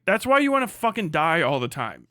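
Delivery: usual speaking voice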